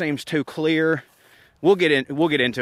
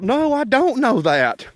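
much higher, slow and louder; mock Trey; 10 semitones higher, 4 dB louder, slower than usual. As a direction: drag out the denial in a squeaky voice. Trey's voice in retold exchange.

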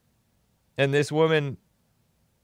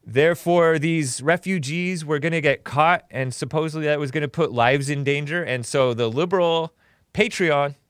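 usual speaking voice

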